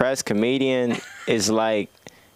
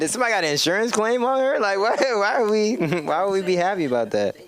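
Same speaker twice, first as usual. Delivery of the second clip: high-pitched disbelieving questions with a laugh; mock incredulous; 8 semitones higher than usual. Real, not acted. Comic objects to cheering a fall.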